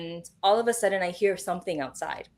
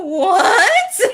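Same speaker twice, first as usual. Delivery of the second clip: high, loud yelp of disbelief; retold shock; 11 semitones higher, 11 dB louder than usual. Hearing the house could be found, hammed-up shock. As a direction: re-enact stunned disbelief, close to laughter.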